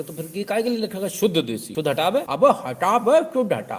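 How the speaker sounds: laughing through a bossy rant; half-laughing mock rebuke; mock scolding. Know-it-all scolds shopkeeper.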